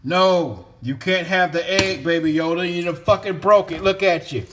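loud scolding of the pet. Real, not acted. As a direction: yell at the dog for breaking the egg. Scolding Yoda over broken egg.

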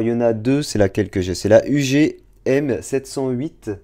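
usual speaking voice

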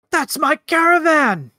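high, drawn-out cry of disbelief; stretched, high-pitched indignant yell; outraged cry. Acted. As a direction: wail in outrage at the loss. Otis sees his caravan escaping.

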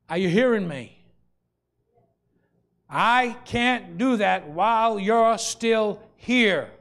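loud, high, drawn-out preaching cry; preaching shout. Real, not acted. Preacher shouting his confession to the congregation, loud and drawn out.

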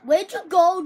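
ordinary delivery

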